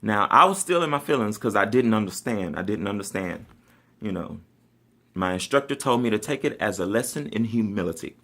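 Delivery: ordinary talk, nothing marked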